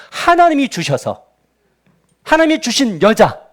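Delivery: high, emphatic accusation; disrespectful blaming. Acted. Adam blaming God for the woman He gave, disrespectfully. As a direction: throw the blame back, each phrase pushed hard. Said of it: "하나님에 대한 존중감도 깨지죠".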